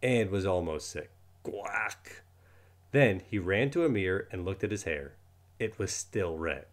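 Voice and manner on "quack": unvoiced whispered duck quack. Narrator adding a whispered quack sound. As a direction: breathe a tiny quack.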